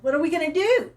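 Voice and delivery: high, loud fretting question; retold dismay. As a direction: ask it as a mock-helpless plea. Retells fretting over dead bunny.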